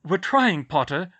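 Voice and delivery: loud, high and curt; snapping. Acted. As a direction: cut him off impatiently. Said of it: "voice snapped".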